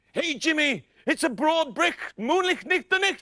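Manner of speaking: mild Scots brogue; a light broad Scots line; Scottish accent. Acted. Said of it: "shout at the top of your voice".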